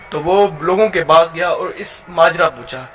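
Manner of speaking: usual speaking voice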